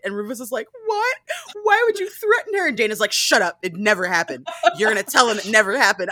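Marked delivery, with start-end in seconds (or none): 0.8-2.7 very high, loud incredulous protest; hammed-up high-pitched outrage; mock shocked child. Retold shocked child, acted quarrel. 3.1-6.1 put-on loud, insistent shushing of a child; hammed-up firm gaslighting; mock angry denial. Retold quarrel, Dana silencing Rufus.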